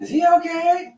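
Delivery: very high falsetto question; put-on high voice, joking; mock concerned resident. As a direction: squeak it like a puzzled old-timer. Echoing imagined nursing-home resident.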